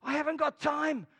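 a hint of a lazy excuse; mocking excuse. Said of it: "some of you people".

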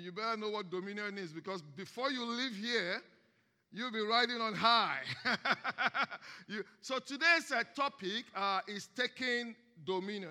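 normal talking voice

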